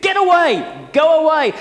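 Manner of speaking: sharp rebuke. Retold or imitated. Disciples chase the parents away, loud sharp rebuke. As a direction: drive them off with a bark. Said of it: "That it's a really sharp rebuke".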